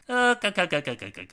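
high start, falling stutter; imitated cackling noise. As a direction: rattle out repeated throaty clucks, falling in pitch. Spelt noise between the plugs.

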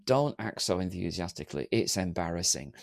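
low, soft embarrassed grumble; frowning disapproval. Acted. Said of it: "frowning, going".

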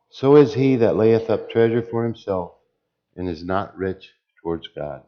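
loud, measured Bible reading; preacher reading the end of the parable; scripture reading. Acted. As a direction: proclaim God's verdict to the congregation. Preacher reads God's reply.

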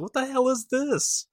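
high, slow mock disbelief; hammed-up shocked high voice; incredulous squeal. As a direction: squeak it out like you can't believe your eyes. First reaction to Bitmojis.

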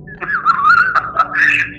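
high breathy laugh. Real, not acted. Guest laughing at the ghost smokers story. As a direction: laugh at the memory.

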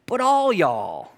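"all y'all" slow, the "all" drawn out and stressed. Shown as a demonstration. Pastor playfully teaching the Texan plural, slow and stressed. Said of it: "The extra all is for emphasis".

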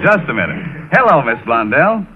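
usual speaking voice

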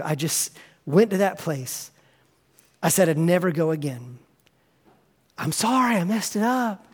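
whisper-quiet sorry, head hung low; hushed remorseful prayer. Acted. Failed believer praying to Jesus, low hushed remorse. Acted confession after falling.

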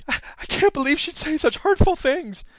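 high, whiny hurt voice of a rich banker; mock wounded banker. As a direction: pout it out in high, wounded self-pity. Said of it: "Jamie Dimon looks at Elizabeth Warren and is like".

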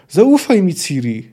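high, loud call for trust; shouted plea. A narrator's reading. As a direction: shout across to the rider. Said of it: "krzyknęła Nimue".

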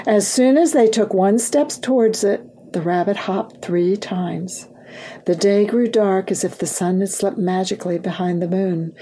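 ordinary delivery, nothing marked

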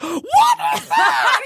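high frightened squeak; scared squeal. Retold or imitated. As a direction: shriek it in fright. Acting her scared reaction.

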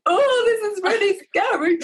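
high, loud mock-frightened wail; a hammed-up cry of fright; scared. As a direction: squeal it like a half-joking scared newcomer. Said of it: "I was like".

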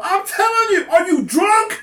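high, exasperated mock shout; retold screaming. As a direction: yell it in disbelief as you retell the row. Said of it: "Miguel's screaming at this guy".

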